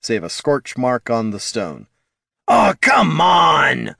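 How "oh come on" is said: loud, high, drawn-out holler; big frustrated shout; exasperated holler. A narrator's reading. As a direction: holler it out, stretching the words. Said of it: "hollered Bert".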